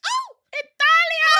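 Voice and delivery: loud, high cry of Italia; excited Italian. Real, not acted. Cheering for the listener from Italy, excited shout. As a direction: belt out Italia with glee.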